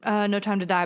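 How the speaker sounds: ordinary talk, nothing marked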